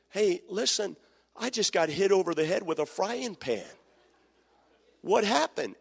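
mock stunned newlywed; bewildered grown child. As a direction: call home dazed and confused. Married child calling parent.

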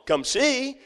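lifted and gentle; high, light invitation. Pretend. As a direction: invite them sweetly. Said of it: "to simply say".

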